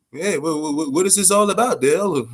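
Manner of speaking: stammering mock-nervous questions; lightly voiced nervous fighter asking why he was invited; nervous Joseph Parker. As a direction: act jittery, tripping over "what, what". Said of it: "nervous as fuck".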